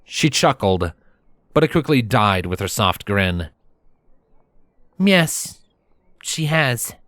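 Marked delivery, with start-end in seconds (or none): 5.0-6.9 solemn princess in a higher voice. Acted. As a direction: confirm it gravely, with a short pause. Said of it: "the princess said more solemnly".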